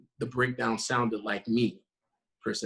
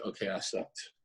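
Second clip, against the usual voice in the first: softer, defeated self-verdict; deflated aside; 4 semitones lower, 8 dB quieter than usual. Retold or imitated. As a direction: mutter it as if you know you blew it. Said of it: "And you're like".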